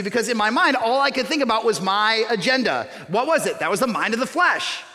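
normal talking voice

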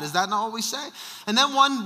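ordinary talk

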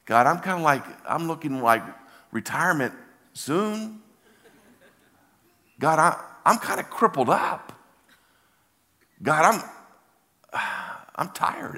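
high-pitched, halting excuses; reluctant protest. Retold or imitated. Tired pastor giving God excuses, high reluctant protest. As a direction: plead your case upward, pausing often.

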